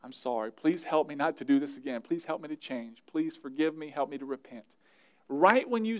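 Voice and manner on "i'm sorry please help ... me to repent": low, soft, humble confession to God; quiet prayer. Shown as a demonstration. Pastor models a prayer of repentance, soft and humble. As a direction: pray it softly, like a sorry child. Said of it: "just say".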